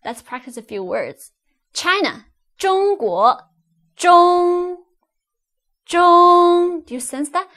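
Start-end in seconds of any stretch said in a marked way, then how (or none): 2.6-3.4 中 high and level before 国 rises; high first tone. Shown as a demonstration. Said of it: "High pitched". 4.0-6.8 中 held loud, high and level; flat high tone. Shown as a demonstration. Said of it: "flat and high pitched".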